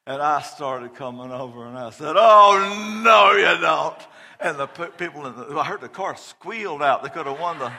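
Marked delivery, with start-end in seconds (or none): 2.2-4.0 loud, high catching-out shout; caught you shout. Retold or imitated. Dad catching his daughter sneaking out, loud shout. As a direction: bust the sneaking kid loudly.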